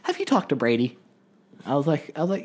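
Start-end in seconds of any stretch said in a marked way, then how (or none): 0.0-1.0 light mom voice, a bit higher; imitating mom. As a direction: quote mom asking casually. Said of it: "she's like".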